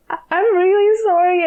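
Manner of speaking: high-pitched retold apology; flustered apology. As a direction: re-enact an anxious apology on arriving late.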